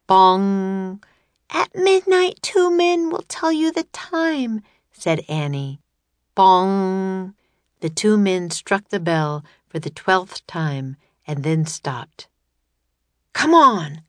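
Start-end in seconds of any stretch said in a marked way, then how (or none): 0.1-1.0 mock bell bong. A narrator's reading. Clock striking. 1.5-4.6 high, bright little sister calling Jack; girl's voice. A narrator's reading. Annie calling to her brother in a much higher voice. 6.4-7.3 mock bell bong. A narrator's reading. Clock striking. 13.3-14.0 cried out. A narrator's reading. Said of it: "cried Jack".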